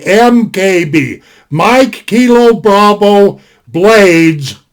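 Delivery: "M K B" letter by letter, slow; slow spelled out. Shown as a demonstration. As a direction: spell it out slowly like a radio call sign. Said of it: "all big letters".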